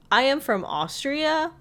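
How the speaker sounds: light Austrian lilt; Austrian accent. Retold or imitated. Marius correcting.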